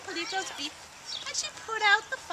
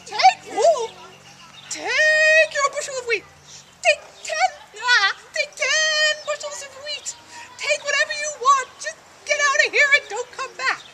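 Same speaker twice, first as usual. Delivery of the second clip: high, loud frightened pleading; terrified king begging the chick to go; scared, hurried giving-in; panicked king; 7 semitones higher, 7 dB louder than usual. Acted. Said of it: "the king cried".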